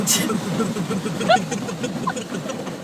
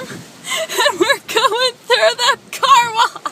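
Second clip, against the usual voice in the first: excited; 12 semitones higher, 6 dB louder, slower than usual. Real, not acted. Excited laughing intro in car wash.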